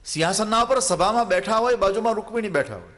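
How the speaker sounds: preacher climbing toward tears; rising preaching fervor. Acted. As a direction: lift the pitch as the story swells. Preacher builds to emotional peak.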